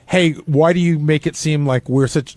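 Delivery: ordinary talk, nothing marked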